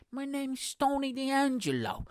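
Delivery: slow mock Italian-American wrestler intro; Tony D'Angelo. Podcaster quoting a pro wrestling character, Italian-American accent. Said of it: "This is how he sounded in twenty twenty-one".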